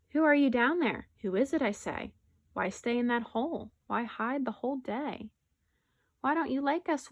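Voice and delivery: animated, expressive story narration; picture-book read-aloud. Acted. Teacher reading a rhyming bear story to pupils, animated storytelling. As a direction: read it lively to young children.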